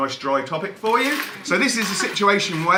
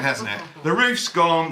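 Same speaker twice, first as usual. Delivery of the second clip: ordinary delivery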